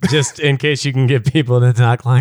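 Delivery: broken-nose character voice. Shown as a demonstration. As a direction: talk as if your nose is broken. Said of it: "talk like this for a while".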